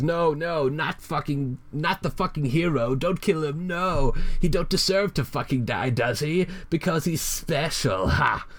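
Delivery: high, sarcastic villain rant. Acted. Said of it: "still ranting".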